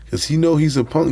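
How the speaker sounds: normal talking voice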